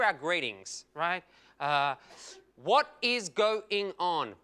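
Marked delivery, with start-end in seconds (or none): 2.6-4.3 "What is going on" slow, syllable by syllable; five separate syllables. Shown as a demonstration. Comic counting syllables of a greeting, "What is going on" deliberately spelled out. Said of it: "five syllables".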